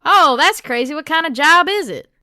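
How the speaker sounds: Southern knight, high and loud; Southern accent. Acted. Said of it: "all of the knights are Southern now".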